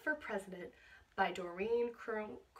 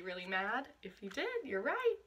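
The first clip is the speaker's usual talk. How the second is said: ordinary delivery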